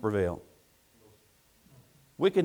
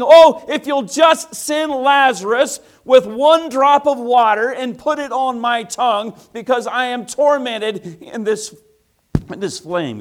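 loud, high, slow anguished plea; tormented rich man; 13 semitones higher, 15 dB louder than usual. Acted. Rich man crying out from the flames, loud anguished plea. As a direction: beg for a drop of water as if burning.

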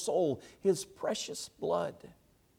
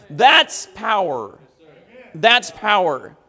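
loud, raised voice, slowing on the climax; triumphant preaching; 8 semitones higher, 14 dB louder than usual. Acted. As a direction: proclaim it with full conviction. Preaches at full force.

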